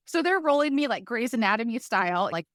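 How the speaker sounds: ordinary talk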